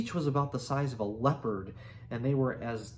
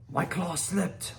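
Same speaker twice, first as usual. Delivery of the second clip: higher, slow whine; 5 semitones higher, slower than usual. A narrator's reading. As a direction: dragon whining an excuse. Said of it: "whined Hookfang".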